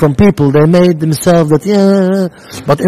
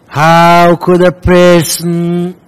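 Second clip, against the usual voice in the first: slow, loud, higher stern rebuke; stern Rebbe; 4 semitones higher, 4 dB louder, much slower than usual. Retold or imitated. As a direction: drag out the words like an angry rebbe. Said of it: "the Rebbe starts saying".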